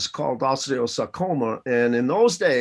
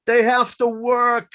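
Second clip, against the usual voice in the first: put-on kids' answer; 10 semitones higher, 6 dB louder, slower than usual.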